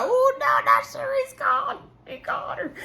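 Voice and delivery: very high squealed gossip reaction, breaking into laughter; gleeful mock shock. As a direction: squeal it in scandalized delight.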